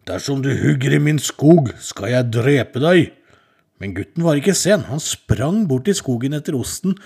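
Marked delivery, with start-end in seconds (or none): 0.1-3.1 loud, slow threatening troll. Acted. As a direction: boom it out like a menacing troll. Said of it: "kom trollet til ham og sa".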